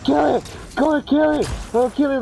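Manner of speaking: hammed-up mom screaming. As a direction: shriek kill it like a panicked mom. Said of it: "starts fucking screaming".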